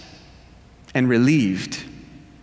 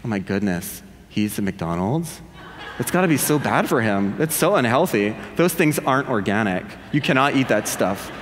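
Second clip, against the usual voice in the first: lower, softer mock judgmental murmur; judgmental inner voice; 7 semitones lower, 3 dB quieter than usual. Audience judging him for McDonald's, lower and softer. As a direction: mutter like a judgy onlooker. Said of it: "in the spirit of comedian Jim Gaffigan".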